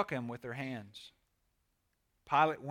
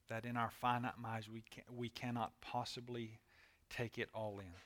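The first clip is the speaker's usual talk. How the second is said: reverent prayer; 4 semitones lower, 7 dB quieter than usual. Real, not acted. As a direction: pray quietly, phrase by phrase. Leads a closing prayer.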